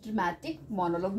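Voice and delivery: softly spaced out, pausing between phrases; word-by-word dictation. Real, not acted. Teacher writing the heading in short, word-by-word dictation. मैंने यहाँ पे short में लिख दिया है.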